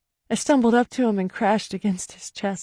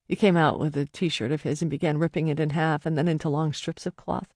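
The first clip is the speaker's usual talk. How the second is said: ordinary delivery, nothing marked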